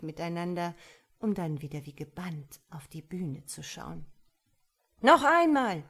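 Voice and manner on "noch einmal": loud, high, eager shout; loud cry. A narrator's reading. The couple demand another blow, loud eager shout. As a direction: cry out greedily for more. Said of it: "riefen sie gleichzeitig".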